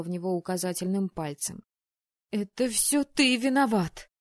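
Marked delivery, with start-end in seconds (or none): 2.3-4.1 high, loud, slow near-tearful accusation; almost crying. Acted. Anna, wrapped in a towel, accuses Weyland almost in tears. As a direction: blame him through tears. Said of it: "чуть не плача заявила я".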